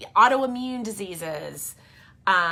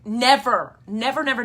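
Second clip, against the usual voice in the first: NEVER hit loud and repeated; emphatic; 4 semitones higher, 8 dB louder than usual. Real, not acted. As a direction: punch each "never" for total insistence. Insisting it never happened before.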